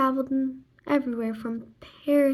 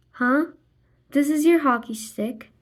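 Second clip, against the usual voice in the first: usual speaking voice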